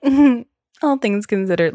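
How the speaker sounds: loud, knowing "mm-hmm" with a pointed repeat; a therapist catching the phrase, mock-pointed; knowing therapist echo. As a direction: echo her words back, drawing them out knowingly. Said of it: "She was like".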